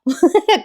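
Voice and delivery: short high giggle; real burst of laughter. Real, not acted. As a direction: laugh breaking through the story. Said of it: "मला जरा हसू आलं". (खरं हसू फुटणे)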